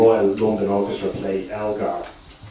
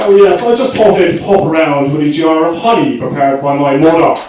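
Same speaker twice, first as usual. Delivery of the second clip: posh English with Dublin mother; 6 semitones higher, 8 dB louder than usual. Retold or imitated. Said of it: "my Dublin accent came out every single time".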